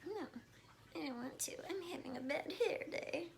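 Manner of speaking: high breathy cat voice, whispered; voicing the cat. Owner voicing her cat, high whispered complaint. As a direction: give the cat a fussy little high voice. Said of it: "she's like".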